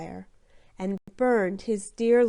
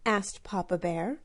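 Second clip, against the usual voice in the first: plain reading voice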